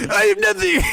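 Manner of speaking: very high, loud mock cry; imitated video outburst. Imitating a guy from a video, high outburst. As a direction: squeal it like an exaggerated viral-video impression. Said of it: "It's some guy trying to pay".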